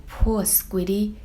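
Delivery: plain reading voice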